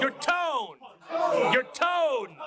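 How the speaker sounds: TONE high, stretched and sliding down; pimp-style tone. Shown as a demonstration. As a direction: croon "tone" high like a smooth pimp. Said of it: "make it sound like on some pimp shit".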